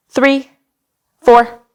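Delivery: ordinary talk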